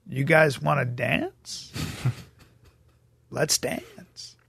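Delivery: slow, soft menacing tough guy; menacing hush. Retold or imitated. Looking round at the men surrounding him, quietly menacing. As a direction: breathe the threat slowly, half whispered. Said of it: "I look around and I go".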